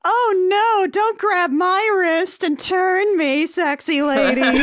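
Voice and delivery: high, slow hammed-up damsel; mock helpless heroine, laughing; mock damsel protest. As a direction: play the swooning victim. Mimics Anna protesting to brides.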